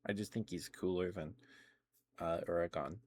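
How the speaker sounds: ordinary delivery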